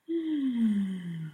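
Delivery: drawn-out sigh after prayer; releasing sigh; long sigh. Real, not acted. Long breath out after amen.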